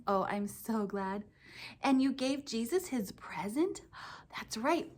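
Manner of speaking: ordinary talk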